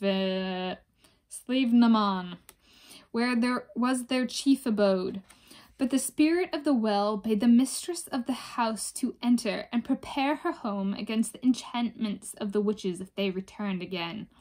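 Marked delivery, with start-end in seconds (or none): none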